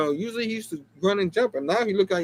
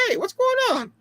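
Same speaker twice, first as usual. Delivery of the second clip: much higher, put-on voice for the Crime Master; mock Crime Master. Crime Master greeting Spider-Man, high put-on voice. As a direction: squeak it out like a cartoon villain.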